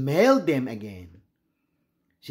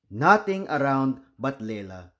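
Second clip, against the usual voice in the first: usual speaking voice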